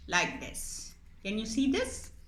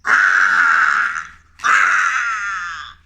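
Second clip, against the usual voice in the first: loud, startled shout; loud cry of alarm; 14 semitones lower, 14 dB louder than usual. Acted. Mouse puppet caught by the cat, loud shout. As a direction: yelp as the cat pounces.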